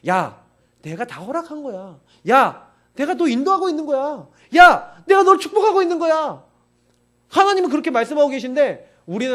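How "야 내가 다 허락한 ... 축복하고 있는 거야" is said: God's assurance. Retold or imitated. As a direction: swell from gentle promise to a proclamation. God speaks to believer.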